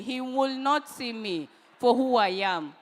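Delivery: usual speaking voice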